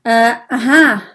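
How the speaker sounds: loud throat-clearing and halting starts; nervous hesitation. A narrator's reading. Minimo asks if the blood test hurts, nervously hesitant. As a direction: stall with ums, then blurt the question. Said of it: "Er räuspert sich und sagt".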